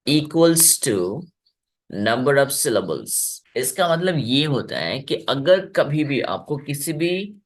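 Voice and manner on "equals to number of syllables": reads the rule out very slowly, word by word; slow reading. Shown as a demonstration. Teacher dictating the rule to students, very slow and deliberate. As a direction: spell the formula out for the class. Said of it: "ये क्या लिखा है?".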